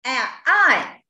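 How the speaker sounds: I snapped out, loud and falling; angry fourth tone. Shown as a demonstration. Teacher showing the fourth tone as an angry shout. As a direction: say I like an angry cry. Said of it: "Angry when you are angry".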